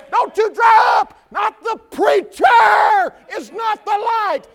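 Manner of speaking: loud, high shouted preaching; full-force preaching. Acted. Preacher rousing the congregation, full-force shouting. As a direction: preach it out to the whole church.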